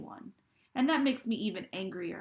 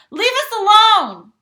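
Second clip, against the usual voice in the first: full-voiced shout, much higher and louder, slow; 13 semitones higher, 22 dB louder, slower than usual. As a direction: scream it across the room at the gun. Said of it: "I shout".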